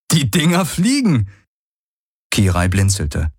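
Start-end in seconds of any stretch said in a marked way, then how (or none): 0.1-1.3 amused excitement. Acted. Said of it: "er lachte".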